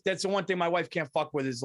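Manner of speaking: ordinary delivery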